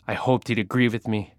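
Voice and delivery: plain reading voice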